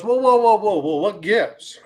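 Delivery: mock-shocked whoa, whoa, slowing down; a lawyer hammily alarmed at what he finds; startled protest. Joke: lawyer shocked arriving in hell.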